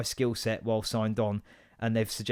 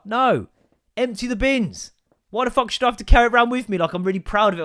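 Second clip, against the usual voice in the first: high-pitched indignant outburst; worked-up comic outrage; exasperated rant; 9 semitones higher, 6 dB louder than usual. Real, not acted. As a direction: protest loudly, voice raised. Ranting about carrying dog bags.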